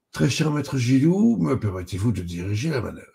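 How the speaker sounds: mock-grave request, high opening; mock solemn. A narrator's reading. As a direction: ask with theatrical, pretend gravity. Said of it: "d'un air faussement sombre". (faussement sombre, solennité théâtrale)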